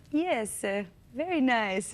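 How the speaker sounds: put-on lightly done Italian accent, slow; a hint of an Italian husband, unimpressed. Wife imitating her Italian husband's reply, light Italian accent. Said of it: "That's an Italian accent".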